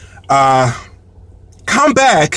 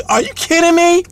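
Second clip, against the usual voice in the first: high-pitched retold disbelief; incredulous; 10 semitones higher than usual. Host finding guests knocked out on the couch, incredulous. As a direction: act out indignant disbelief, pitched way up.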